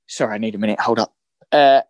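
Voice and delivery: throat catching, voice choked; clearing a frog in the throat. Real, not acted. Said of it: "I just had a frog in the throat".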